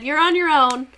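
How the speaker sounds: high, loud mock blessing of a president; hammed-up, carefree politician voice; loud sing-song brush-off of a president; mocking Hoover. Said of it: "He said".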